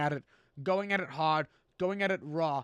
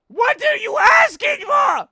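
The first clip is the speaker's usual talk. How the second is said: high, loud outraged yell; furious shout; 15 dB louder than usual. Acted. Chef at the customer, shouting in outrage. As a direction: scream at the customer's baffling order.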